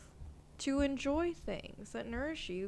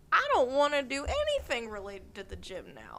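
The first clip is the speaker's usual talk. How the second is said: balky refusal; 4 dB louder than usual. Acted. Podcast host acting out resistance to the gym, balky refusal. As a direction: refuse it emphatically.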